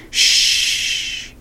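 sharp breathy hush; shushing. A narrator's reading. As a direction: Rob hushing Sistine as the tiger listens. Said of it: "said Rob".

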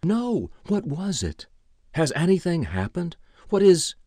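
high, anxious questioning; alarmed. A narrator's reading. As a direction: ask in rising alarm. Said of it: "Ira Bennett's eyes widened".

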